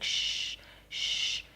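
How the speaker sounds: voiceless shushing; imitated hushing. Attacker trying to hush her, imitated voiceless shush. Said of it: "he's trying to hush me".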